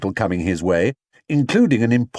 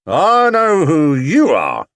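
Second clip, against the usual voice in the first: slow, louder, grim accusation; 6 semitones higher, 6 dB louder, slower than usual. Acted. Detective confronting the bear, slow and grim. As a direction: lay it down grimly. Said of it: "he said grimly".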